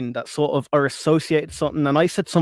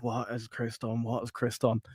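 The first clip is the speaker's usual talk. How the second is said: muttered under the breath, much softer, slow; a soft, slowed, worried aside; hushed worried muttering; 3 semitones lower, 11 dB quieter than usual. Retold or imitated. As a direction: mutter it to yourself in alarm. Imagined reaction on seeing trending.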